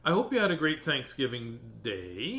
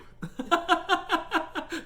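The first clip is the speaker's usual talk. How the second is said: high giggle; 5 dB louder than usual. Real, not acted. Laughs at hiccups.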